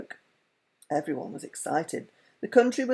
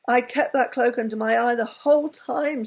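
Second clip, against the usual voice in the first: sobbed; 6 semitones higher, 5 dB louder than usual. A narrator's reading. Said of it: "sobbed Miggy".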